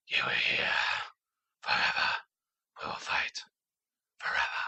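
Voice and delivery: low, whispery action-hero growl impression; John Connor voice. Waiting for Gendry to talk like Bale, mock gruff whisper. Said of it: "his Batman voice is also his John Connor voice".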